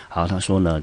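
ordinary talk, nothing marked

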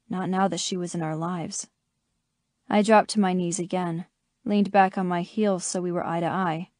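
ordinary delivery, nothing marked

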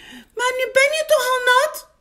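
very high, loud little voice; squeaky mouse voice. A narrator's reading. Muis points out Big is wet, squeaky and loud. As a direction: pipe up like a tiny mouse. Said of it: "zegt Muis".

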